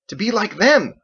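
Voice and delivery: high, loud burst of a character voice; excited exclamation. Acted. Andelin exclaims to Biggs, high and loud. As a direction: blurt it out with feeling.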